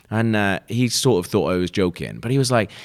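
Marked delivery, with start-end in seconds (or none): none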